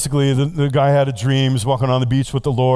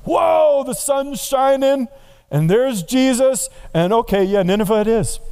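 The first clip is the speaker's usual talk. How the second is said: startled whoa; 9 semitones higher than usual. Acted. Preacher playing Jonah, suddenly seeing Jesus, amazed. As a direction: gasp like the sun just broke through.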